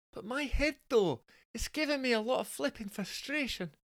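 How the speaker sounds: high, soft Scottish falsetto; plaintive high-pitched Scottish wife; Scottish woman. Acted. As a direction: whinge as a hungover Scottish wife. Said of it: "Jessica is actually Scottish".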